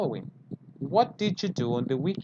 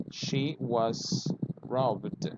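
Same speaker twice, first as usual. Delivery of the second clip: She was robbed, each word slowly, robbed ending in a d sound; slow model sentence; 3 dB quieter, much slower than usual. Shown as a demonstration. Teacher slowly models She was robbed for students. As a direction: spell it out word by word. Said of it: "you can say, for example".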